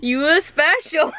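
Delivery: put-on slow, high, giggly Jamaican accent; imitated Jamaican accent. Manager teasing employee, giggly mock Jamaican accent. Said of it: "imitate this Jamaican man".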